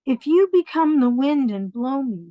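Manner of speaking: put-on bunny child answering his mother; little bunny voice.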